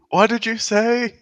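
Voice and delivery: high, loud mock yell; deafened shout. Deafened party asking what was said, high mock shouting. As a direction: call out as if you can't hear a thing.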